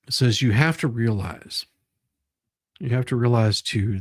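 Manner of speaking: ordinary talk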